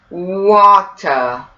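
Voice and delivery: drawn-out British water, "waw-tuh"; slow British-accent demo of water, no R. Shown as a demonstration. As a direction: say water British with a long W and crisp T. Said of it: "let's try it in a British accent".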